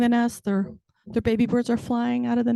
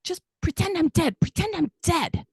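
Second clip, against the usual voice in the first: mock yelling; 8 semitones higher than usual. Frustrated mom yelling upstairs at the kids, exasperated mock yell. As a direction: yell it as a fed-up parent, pitch jumping up. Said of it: "I'm, like, yelling".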